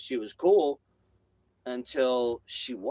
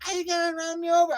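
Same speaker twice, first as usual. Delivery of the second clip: very high screechy imitation of her; mock shriek it in one high stream; retold screaming; 4 dB louder than usual. Woman making a scene in the street, mock screaming. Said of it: "I hear some screaming".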